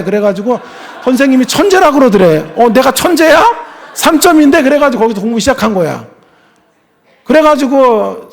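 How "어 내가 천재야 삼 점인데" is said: much higher and louder; surprised disbelief. Retold or imitated. Son amazed that the teacher calls him a genius, high and loud. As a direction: ask it amazed at yourself.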